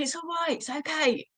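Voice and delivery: nervous, fussing comfort; worried high reassurance. Shown as a demonstration. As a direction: owner demonstrating the wrong way to soothe a dog. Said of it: "if I kind of go from this to".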